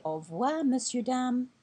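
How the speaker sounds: Au revoir, monsieur, dame with a lifted ending; polite French farewell; French goodbye. Shown as a demonstration. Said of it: "you also say".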